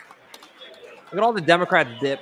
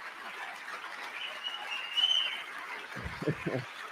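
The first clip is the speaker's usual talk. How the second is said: breathy laugh; 13 dB quieter than usual. Real, not acted. Laughs after his own mock line.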